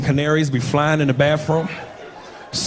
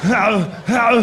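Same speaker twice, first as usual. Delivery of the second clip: drawn-out hero howl; two long, put-on groans; imitated movie grunt; 3 semitones higher than usual. Showing where the noise came from, mock groaning. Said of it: "remember where I got that".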